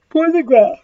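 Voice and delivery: words stretched through a real yawn. Real, not acted. Teacher fighting yawns while explaining a graph, yawning. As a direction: yawn mid-phrase, pitch lifting. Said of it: "sorry, I can't stop yawning".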